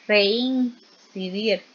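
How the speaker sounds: "reincidir" very slow, rein held apart; split into syllables. Shown as a demonstration. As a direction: stretch "reincidir", keeping e and i together in rein. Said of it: "Veamos la combinación". ("reincidir" muy lento, separando rein)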